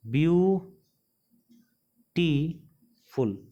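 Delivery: "Beautiful" very slowly, long pauses between view, टी and फुल; teacher's syllable-by-syllable "beautiful"; broken into syllables. Shown as a demonstration. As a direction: split "beautiful" into three drawn-out, isolated beats. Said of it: "तीन part में टूटा".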